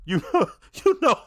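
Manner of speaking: cracking up mid-phrase; real laugh through the words. Real, not acted. Cracks up mid-sentence about shootouts.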